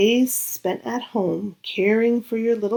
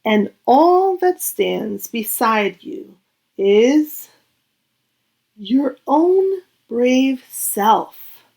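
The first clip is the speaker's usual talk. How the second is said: slow and louder, lean on own brave self; slow, swelling emphasis; 3 semitones higher, 3 dB louder, slower than usual. A narrator's reading. Narrator encouraging the child reader, slow emphatic build. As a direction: build each word with weight. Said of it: "narrator builds the brave moment".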